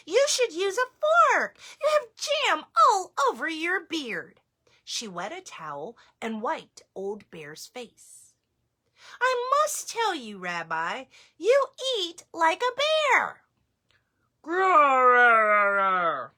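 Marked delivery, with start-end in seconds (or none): 0.1-4.4 high chiding grandma voice; grandmother voice. Acted. Baba Brena scolding the messy bear, high. 9.2-13.4 high teasing grandma voice; grandmother voice. Acted. Baba Brena teasing the bear, high. 14.4-16.3 loud rolling growl; bear growl. Acted. Bear answering Baba Brena, loud growl.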